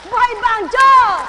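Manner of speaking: loud, high rallying shout; shouted call. Real, not acted. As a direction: rouse the crowd with the call. Preacher shouts to congregation. (malakas na sigaw sa kongregasyon)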